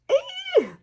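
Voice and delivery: 2 a high, loud squeal of delight; 3 squeal it like the look thrilled you; 4 excited high-pitched squeal. Real, not acted. Delighted by her makeup look.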